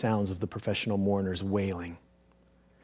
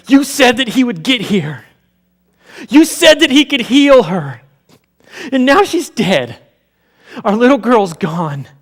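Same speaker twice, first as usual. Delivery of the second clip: much higher and louder, slow anguished wail; grieving outburst; 11 semitones higher, 13 dB louder than usual. Acted. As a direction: cry it out at your husband in despair. Grieving mother confronts husband.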